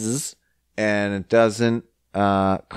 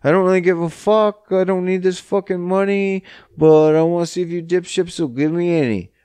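mock don't-care pitch; 7 semitones higher, faster than usual. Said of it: "You just be like".

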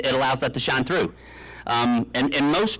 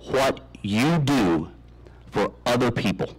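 slow dramatic; slower than usual. Acted. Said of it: "slow dramatic delivery".

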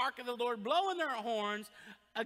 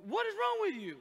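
exasperated wife; 7 semitones higher, 4 dB louder than usual. Retold or imitated. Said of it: "And she's like".

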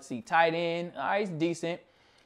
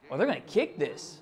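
ordinary delivery

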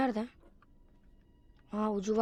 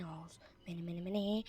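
whisper; 3 semitones lower, 8 dB quieter than usual. Real, not acted. Spooky whisper at end.

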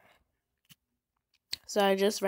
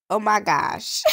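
surprised; 3 semitones higher, 10 dB louder than usual. Real, not acted. Reacting to weird game messages.

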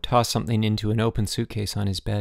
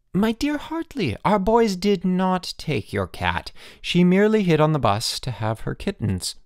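smug mock sweetness; 9 semitones higher than usual. A narrator's reading. Headmaster mocking on phone.

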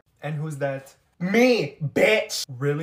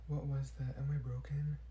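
breathy, hushed whisper; 2 semitones lower, 12 dB quieter than usual. Acted. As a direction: whisper it nervously. Startled by strange noise.

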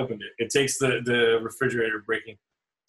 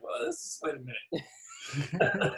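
laughing; 3 semitones higher, 7 dB quieter, much slower than usual. Retold or imitated. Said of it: "but I was like".

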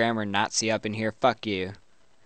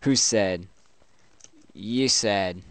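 ordinary talk

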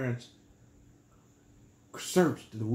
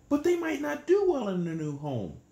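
worried high character voice; 12 semitones higher, 3 dB louder than usual. Acted. Worried character in beaver story.

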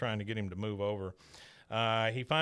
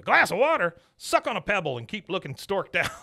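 louder, higher scornful bark; gruff Cotton bark; 7 semitones higher, 7 dB louder than usual. Retold or imitated. Cotton scornfully answering Tilly at Yankee Stadium, loud. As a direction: scoff at her like a grumpy old soldier. Cotton barking at young wife.

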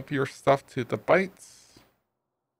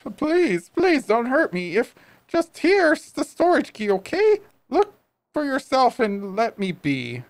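very high, breaking plea of a scared shopkeeper; a cornered merchant begging for mercy; terrified pleading; 9 semitones higher than usual. Acted. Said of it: "I made him cry".

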